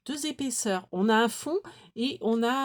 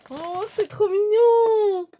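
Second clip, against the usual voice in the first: much higher, louder, slow squeal of delight; cute squeal; 12 semitones higher, 9 dB louder, much slower than usual. Real, not acted. Vlogger squealing at the cute gift, high and slow. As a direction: melt over it with a long squeal.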